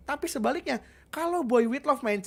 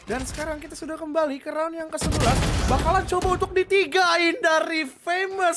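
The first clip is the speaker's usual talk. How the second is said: rising hype, loud and higher; fighting game announcer; 5 semitones higher, 6 dB louder than usual. Real, not acted. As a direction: call the round like a big finale. Hyped live play-by-play.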